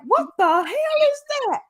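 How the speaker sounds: high, startled what-the-hell; mock shocked outburst; shocked exclamation. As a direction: blurt it out in disbelief. Quoting own shocked reaction.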